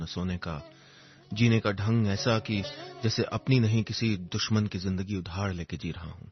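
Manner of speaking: usual speaking voice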